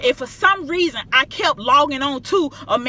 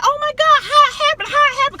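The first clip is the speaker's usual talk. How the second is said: much higher, louder near-crying exclaim; frantic disbelief; 6 dB louder than usual. Retold or imitated. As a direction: shriek it out in joyful disbelief. Retelling disbelief, flight found.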